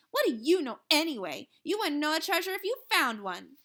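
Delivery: angry mother yelling back; loud, indignant scolding. A narrator's reading. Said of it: "His mother yelled at the big man".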